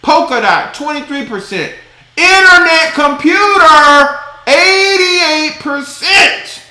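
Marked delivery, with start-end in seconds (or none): 2.2-6.6 high, slow, drawn-out astonishment; amazed outcry. Real, not acted. Vlogger reading out a huge gain to viewers, amazed and drawn out. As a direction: stretch it out in disbelief.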